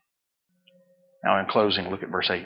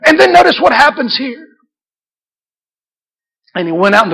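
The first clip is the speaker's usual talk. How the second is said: high, loud, fervent preaching; full-force preaching; 11 dB louder than usual. Acted. Pastor urging the church to expect a miracle, fervent preaching.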